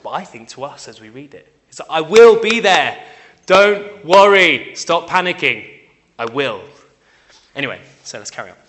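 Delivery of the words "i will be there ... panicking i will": loud, high, slow and forceful; acted-out insistent shout, words stretched; emphatic shouted reassurance. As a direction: boom out each word to stop the panic. Acted emphatic reassurance to panicking disciples.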